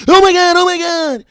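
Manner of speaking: high, loud mock panic; mock the panicking viewers. Mocking viewers who panic when the stream breaks, hammed-up panic. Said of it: "Everybody starts panicking".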